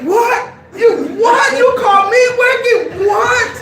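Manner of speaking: very high, loud mock outrage with a laugh; outraged disbelief. Outraged at being called wicked.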